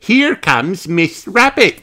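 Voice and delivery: put-on high, slow little girl voice; Peppa voice. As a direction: voice a pleased piglet.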